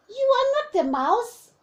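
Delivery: high, loud little monster; child monster facing the snake. Acted. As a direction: accuse it boldly in a high voice. Child faces creature.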